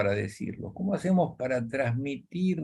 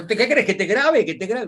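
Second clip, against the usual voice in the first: higher-pitched acted comeback; mock quarrelling retort; 10 semitones higher, 4 dB louder than usual. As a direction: fire back as the other half of the couple. Acted reply in couple's quarrel. (réplica aguda de pelea, actuada)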